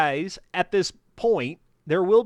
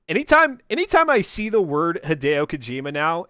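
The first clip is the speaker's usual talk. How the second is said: ordinary talk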